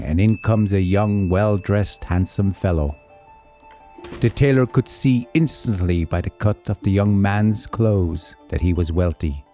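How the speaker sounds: usual speaking voice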